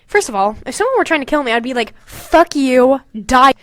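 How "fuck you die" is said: ordinary delivery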